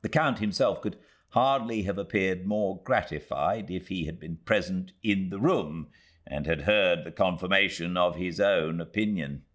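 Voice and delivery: plain reading voice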